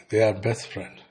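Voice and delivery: ordinary talk, nothing marked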